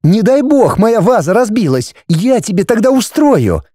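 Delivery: high-pitched threatening wife; menacing indignation. A narrator's reading. Wife threatening husband over vase, indignant. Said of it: "грозно уставившись на мужа".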